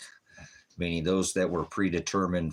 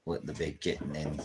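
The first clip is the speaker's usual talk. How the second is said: ordinary delivery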